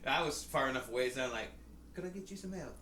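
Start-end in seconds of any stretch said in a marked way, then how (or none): 1.9-2.8 soft, high mock British offer; lightly higher British-accented voice. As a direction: imitate a polite British helper quietly. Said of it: "I said in a British accent".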